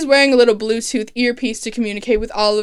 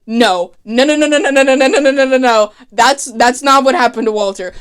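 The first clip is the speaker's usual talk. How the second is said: angry denial; 2 semitones higher, 7 dB louder than usual. Retold or imitated. Moira yelling her denial at Oliver. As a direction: refuse to believe it, louder and louder. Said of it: "is angry as fuck".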